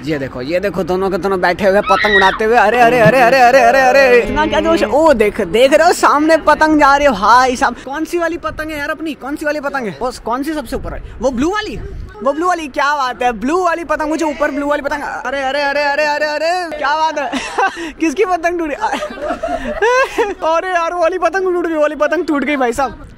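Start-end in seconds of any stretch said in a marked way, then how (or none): 2.6-7.8 loud, thrilled cries at the kites; excited shouting. Real, not acted. Shouting to his friend as the kites battle, excited and loud. 18.8-20.4 sudden high laugh; high burst of laughter. Real, not acted. Laughing as a rival kite gets cut, high delighted laugh.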